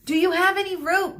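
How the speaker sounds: high, loud call. Acted. Medusa calling across the chasm, loud high call. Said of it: "Medusa called".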